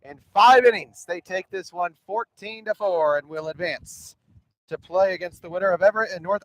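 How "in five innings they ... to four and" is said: loud, rising, slowed-down final call; excited game-winning call. Acted. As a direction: shout the last out with rising excitement. Game-ending out called.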